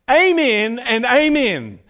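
slow, loud, weighty Amen and amen; each amen drawn out and grave; solemn amen. Acted. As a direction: declare it like an oath. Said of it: "It's the same way of saying".

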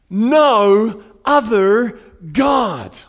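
slow, loud shout of each word; emphatic shout. Acted. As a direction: hammer it home, slowly and loudly. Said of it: "it's so emphatic here".

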